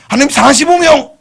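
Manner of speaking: high and loud, pushing his luck; eager haggling. Acted. Abraham bargaining with God, high and eager.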